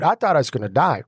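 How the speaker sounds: ordinary delivery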